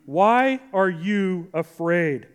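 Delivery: half-speed "Why are you afraid", each word spaced out; slow, deliberate. Real, not acted. Preacher putting the sermon's question to the congregation, slow and deliberate. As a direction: draw the question out slowly. Question repeated slowly for emphasis.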